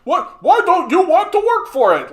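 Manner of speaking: very high, loud mocking voice; shrieky caricature of an entitled advice-giver; mocked advice-giver. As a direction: ridicule him in a loud falsetto. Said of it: "thinks that he has advice".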